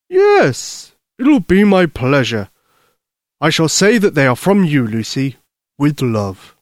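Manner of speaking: delighted Santa Claus. Acted. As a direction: agree gladly and grandly as Santa.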